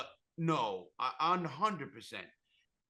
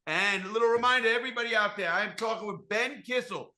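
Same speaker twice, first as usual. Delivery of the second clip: higher, louder announcer read; host plug read; 9 semitones higher, 12 dB louder than usual. Acted. Reminding listeners about his guest's new show, announcer voice.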